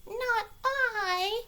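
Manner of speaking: the cat says no, high; cat's refusal. Acted. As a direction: squeak it out like a cat. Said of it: "said the cat".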